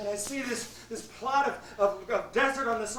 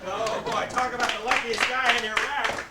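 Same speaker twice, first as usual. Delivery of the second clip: usual speaking voice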